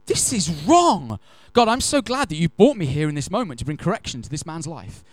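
fuming, self-righteous complaint to God; indignant prayer. Retold or imitated.

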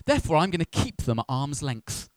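ordinary talk

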